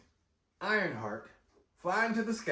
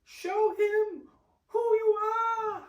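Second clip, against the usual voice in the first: much higher and slower, commanding from above; slow, regal voice from the heavens; Angela Bassett voice; 5 dB louder, much slower than usual. Retold or imitated. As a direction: call down like a queen from the clouds. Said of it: "you hear Angela Bassett".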